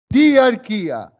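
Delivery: diarquía drawn out slowly, each syllable clear; slow emphatic term. Shown as a demonstration. Teacher revealing the answer: diarquía said slowly and clearly. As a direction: spell out diarquía slowly for the class. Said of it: "Eso se llama con el nombre de".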